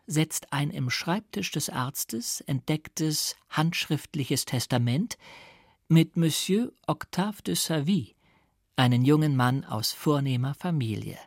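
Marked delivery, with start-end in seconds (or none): none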